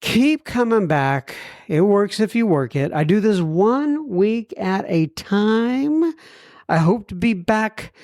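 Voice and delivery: high, drawn-out podcast outro; show sign-off. Real, not acted. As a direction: sign off with slow, stretched-out phrases. Signs off the podcast episode.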